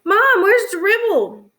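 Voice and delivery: high, loud call to Mom, drawn out; hollering. A narrator's reading. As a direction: holler across the house in alarm. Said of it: "rushed into the kitchen and hollered".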